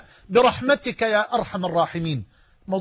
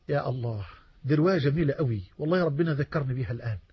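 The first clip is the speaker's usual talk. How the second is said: moved aside; 6 semitones lower, 5 dB quieter, faster than usual. Real, not acted. Moved aside remembering a narration.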